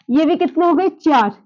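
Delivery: ordinary delivery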